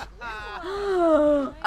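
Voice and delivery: sharp high gasp of shock. Real, not acted. Gasping as her rival walks into the nightclub. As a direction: catch your breath in disbelief.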